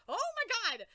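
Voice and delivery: high, loud "oh my god"; astonished outburst. Retold or imitated. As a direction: blurt it out in shock. Reacting to the comic.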